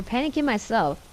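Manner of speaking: plain reading voice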